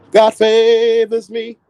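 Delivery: high, slow and grandiose; lofty drawn-out diva boast; grand diva voice. Pretend. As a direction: proclaim it like a chosen diva.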